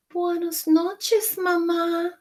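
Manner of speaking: sleepy goodnight; baby chick goodnight. Acted. Chick saying goodnight to Jack. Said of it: "said the baby chick".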